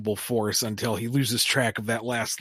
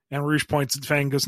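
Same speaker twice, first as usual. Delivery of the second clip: normal talking voice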